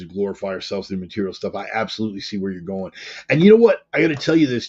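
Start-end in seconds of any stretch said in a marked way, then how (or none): 3.3-4.7 jumps much higher and louder on "know"; excited burst. Real, not acted. Host switching eagerly to his home church, excited burst. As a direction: pounce on the new point. Sudden launch into new topic.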